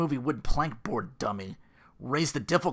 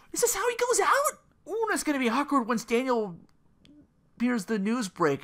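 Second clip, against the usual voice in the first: high excitement; 12 semitones higher, 4 dB louder than usual. Real, not acted. Reacts to the stabbing scene.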